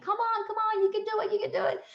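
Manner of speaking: very high, small-voiced imitation of cheering; mock yelling up. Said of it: "they were yelling up at me".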